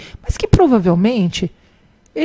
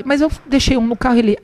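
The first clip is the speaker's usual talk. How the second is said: ordinary talk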